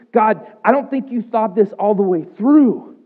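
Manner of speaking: high, loud, slow lament; grieving father telling God he's wrong; anguished protest. Retold or imitated. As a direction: pour out the grief at God. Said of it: "And, like, I just".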